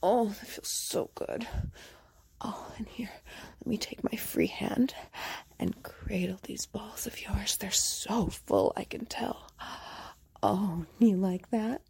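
ordinary delivery

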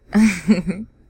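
loud mocking laugh; teasing laugh. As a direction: burst out laughing at him. Teasing laugh mid-roleplay.

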